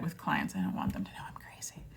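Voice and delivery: hushed aside whisper. Real, not acted. Confiding to viewers about clients, whispered. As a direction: whisper it like a secret confession. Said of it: "unvoiced whisper".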